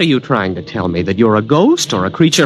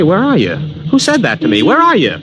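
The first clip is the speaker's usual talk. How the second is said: calling out; 12 semitones higher than usual. Acted. Calling out to unseen voice.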